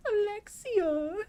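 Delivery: very high, drawn-out crazy voice; all weird. Shown as a demonstration. Demonstrating a super crazy voice for Alfred, all weird. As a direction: stretch the name out weirdly, way up high. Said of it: "I'll say it all weird".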